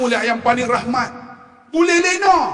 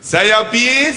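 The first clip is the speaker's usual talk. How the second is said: usual speaking voice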